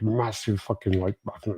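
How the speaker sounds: ordinary talk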